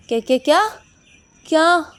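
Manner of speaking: loud, high, repeated exclamation; shocked disbelief. Acted. Shocked at her announcement. (चौंककर ऊँची आवाज़ में)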